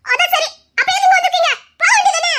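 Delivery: annoyed retort. Acted. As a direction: shoo him off in a huff. Angry jealous girlfriend snaps back. (பொறாமையில் கோபமான பதில்)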